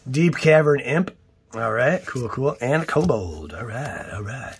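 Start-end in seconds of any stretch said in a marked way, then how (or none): none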